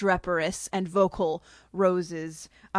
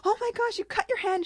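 much higher panicked squeal; Alice panicking at the cut hand, high squeal; freaking out; 14 semitones higher than usual. Retold or imitated. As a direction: panic at the sight of blood. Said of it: "Alice is freaking out".

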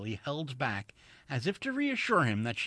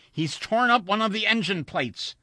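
agitated, higher and louder; alarmed report in a shaking voice; 10 semitones higher, 8 dB louder than usual. A narrator's reading. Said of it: "said Wilson in a shaking voice".

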